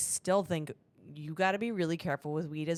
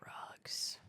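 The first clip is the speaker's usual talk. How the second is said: breathy whispered echo of the topic; playful dramatic whisper; mock hushed whisper; 12 dB quieter than usual. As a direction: hush it like a forbidden word. Echoing the topic in a hush.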